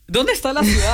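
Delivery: arriving at the airport, laughing at her own shock; amused disbelief. Retold or imitated. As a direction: act out the stunned newcomer with a laugh. Arriving at small Alabama town.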